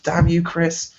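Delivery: high-pitched playful grumble; retold comic complaint; mock cursing. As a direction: curse a friend in joking exasperation. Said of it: "And I'm like".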